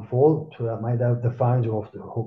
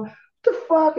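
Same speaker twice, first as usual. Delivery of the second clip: much higher, stunned what-the-fuck; mock amazement, pitched way up; retold disbelief; 4 dB louder than usual. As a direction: act floored by it. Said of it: "I'm just kinda going".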